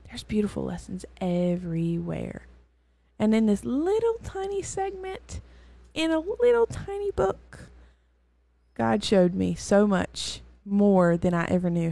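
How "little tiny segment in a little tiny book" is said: high, small voice for something little; tiny voice. Real, not acted. Marveling at a tiny Bible book, high little voice. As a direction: shrink the voice up high to sound tiny.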